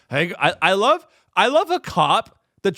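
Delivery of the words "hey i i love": ordinary delivery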